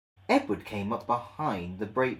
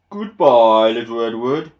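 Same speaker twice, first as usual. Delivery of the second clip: loud, proud goodbye; Gordon's farewell; 13 dB louder than usual. Acted. Proud engine calling goodbye to Edward. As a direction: call it out grandly as you leave. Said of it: "said Gordon as he puffed away".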